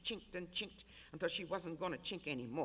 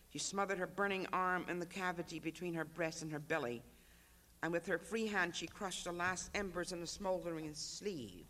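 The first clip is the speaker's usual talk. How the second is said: ordinary delivery, nothing marked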